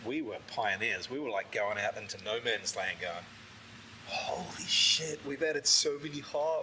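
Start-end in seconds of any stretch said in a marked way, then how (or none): 4.1-6.6 slow, hushed, awestruck whisper; awed whisper. Retold or imitated. Brewers stunned by their own hoppy beer, whispering in awe. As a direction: breathe it out in disbelief.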